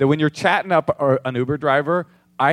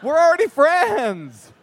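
high, louder, drawn-out plea; dismayed protest; 12 semitones higher, 4 dB louder, much slower than usual. Retold or imitated. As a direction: cry out in mock dismay. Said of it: "You're like".

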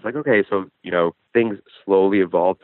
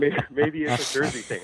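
laughing; 7 semitones higher, 4 dB quieter than usual. Real, not acted. Caller laughing along with hosts.

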